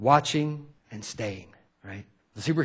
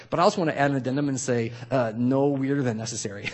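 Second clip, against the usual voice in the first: ordinary delivery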